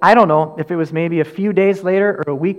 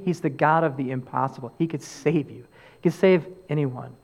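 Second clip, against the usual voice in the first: soft, lower, reassuring; 3 semitones lower, 7 dB quieter than usual. Acted. Pastor inviting listeners to salvation, soft and reassuring. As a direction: tell them gently that anyone can be saved.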